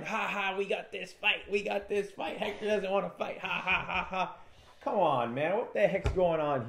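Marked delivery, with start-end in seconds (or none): 0.0-4.4 high, taunting mock laugh of the rival corner; mocking corner laughter. Rival corner gloating at Hector, mock laughing. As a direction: gloat like the other team. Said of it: "his whole corner laughing".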